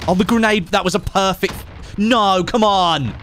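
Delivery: high, loud frustrated outburst; exasperated yell at the grenade; combat callout. Real, not acted.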